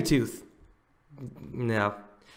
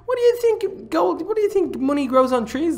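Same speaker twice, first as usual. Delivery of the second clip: much higher, louder indignant rant; outraged; 10 semitones higher, 6 dB louder than usual. Real, not acted. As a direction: balk at the ridiculous price. Outraged at shop prices.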